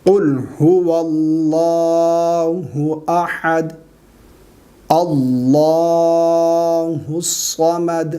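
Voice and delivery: long drawn-out chanted recitation; slow Quran recitation. Shown as a demonstration. Teacher recites the surah for students, slow and chanted. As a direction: recite slowly, stretching every vowel.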